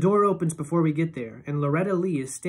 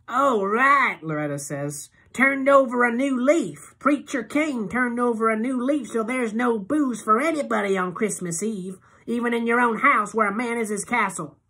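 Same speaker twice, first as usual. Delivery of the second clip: put-on sarcastic drunk; 8 semitones higher, 6 dB louder than usual. A narrator's reading. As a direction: sneer it out tipsy. Loretta voiced, sarcastic drunk.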